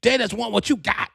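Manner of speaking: high-pitched cousinly warning; high voice warning him off; imitating cousin T T. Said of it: "This is how T T talk".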